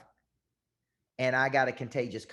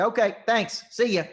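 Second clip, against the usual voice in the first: curt brush-off; 8 semitones higher, 6 dB louder than usual. Retold or imitated. Agent gives price, caller hangs up.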